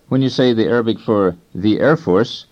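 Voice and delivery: ordinary talk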